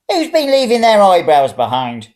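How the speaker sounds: put-on high, loud, indignant old woman; an old lady grumbling at the carpet; frowning old woman. A narrator's reading. Old woman scolds about eyebrows on the carpet, high and indignant. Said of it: "she said, frowning".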